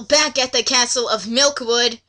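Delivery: ordinary delivery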